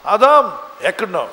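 loud, drawn-out calling; feigned unknowing call. Acted. God calling for hidden Adam as if unknowing, loud. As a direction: call out slowly, as if searching. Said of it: "ఆయన ఎరగనట్టే".

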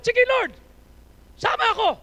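loud, eager willing reply; right answer. Shown as a demonstration. Pastor showing the right reply to God, loud and eager.

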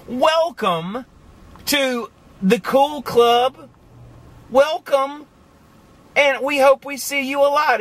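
high, drawn-out welcome; warm welcome. Acted. Vlogger welcoming January newcomers, high and slow. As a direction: roll out the welcome mat for newcomers.

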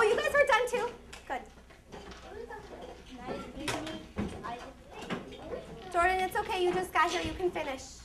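high, bright call to the whole room; rally the class with cheers and orders; classroom teacher voice. Real, not acted. Teacher addressing class in raised voice.

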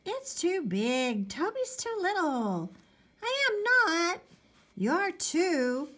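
put-on high, drawn-out kid squabble; bickering kids. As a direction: argue like two little brothers, pitched high. Boys bickering in the story.

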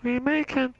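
high slow goofy voice; high put-on voice. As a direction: stretch it out high and silly. Echoing a buddy's catchphrase in a put-on voice.

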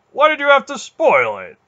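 very high, loud protest; complaining. A narrator's reading. Bear whose fight was spoiled, high loud complaint. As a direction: complain loudly, pitch shooting up. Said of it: "Bear complained".

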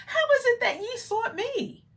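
put-on very high, questioning boy voice; imitating the boy. Acts out boy Jesus in King James.

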